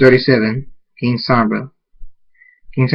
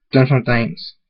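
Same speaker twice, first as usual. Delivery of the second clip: ordinary delivery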